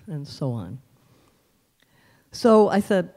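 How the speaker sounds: ordinary delivery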